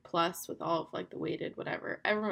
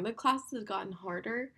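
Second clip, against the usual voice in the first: ordinary talk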